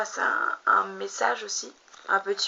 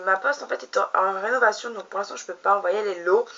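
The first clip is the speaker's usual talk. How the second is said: usual speaking voice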